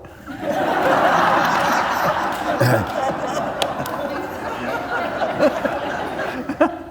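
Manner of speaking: long burst of high, wheezy laughter; laughing. Real, not acted. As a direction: crack up at your own story. Laughs at own punchline.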